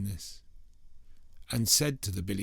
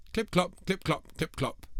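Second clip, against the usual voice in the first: clip-clop starting much higher and falling; 9 semitones higher than usual. A narrator's reading. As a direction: ring out each hoof step. Big goat on the bridge.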